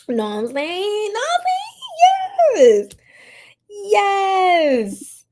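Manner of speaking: high, slow words through laughter; laughing. Real, not acted. Streamer jokes with chat, laughing through the words. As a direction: squeal it while laughing.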